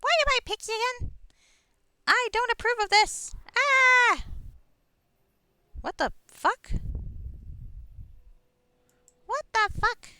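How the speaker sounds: high, squeaky tiny fairy voice; pixie voice. Acted. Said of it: "I forgot how to do a pixie voice".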